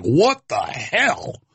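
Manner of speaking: slow, drawn-out stunned what-the-hell; stunned disbelief. Retold or imitated. Viewer of the footage, slowly stunned in disbelief. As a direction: let it hang in slow shock. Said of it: "you're just like".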